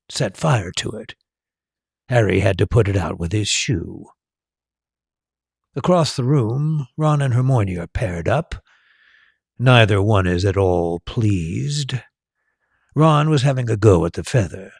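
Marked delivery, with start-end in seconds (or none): none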